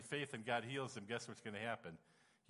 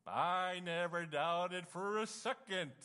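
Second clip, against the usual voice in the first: mock-proud boast; 6 semitones higher, much slower than usual. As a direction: stretch out "I never doubted for a second" slowly, playing proud. Boasting after doubting.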